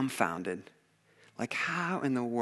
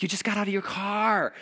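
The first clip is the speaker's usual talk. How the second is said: higher-pitched incredulous protest; comically pained complaint; mock exasperation; 8 semitones higher than usual. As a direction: act out disbelief at a clumsy mishap. Acting out his disbelieving reaction.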